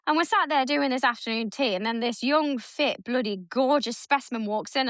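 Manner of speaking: normal talking voice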